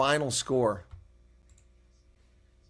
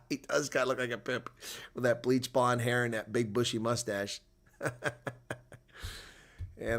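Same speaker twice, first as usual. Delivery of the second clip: ordinary delivery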